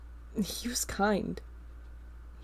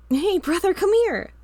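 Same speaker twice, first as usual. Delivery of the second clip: high, loud call across the house; child calling out; 11 semitones higher, 9 dB louder than usual. Acted. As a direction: shout it up the stairs like an excited kid. Little brother calling from upstairs.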